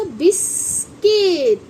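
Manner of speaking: बिस्किट split slowly, "bis... kit"; correct pronunciation. Shown as a demonstration. Teacher correcting children on biscuit, slow syllable by syllable. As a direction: draw out बिस्किट, ending in "kit". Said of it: "जबकि इसे बोलते हैं".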